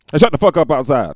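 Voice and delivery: loud irritated yell out the window; shouting outside. Real, not acted. Yelling at noisy people outside while recording, loud and annoyed.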